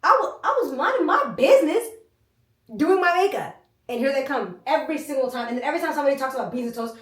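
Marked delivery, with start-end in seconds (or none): 0.0-3.5 indignant. Real, not acted. Indignant telling of being bothered.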